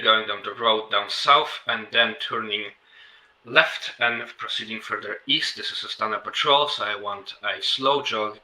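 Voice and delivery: loud, commanding squad call; shouting orders. Real, not acted. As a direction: project it across the field. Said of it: "I'm gonna be on shouting".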